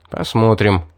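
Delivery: plain reading voice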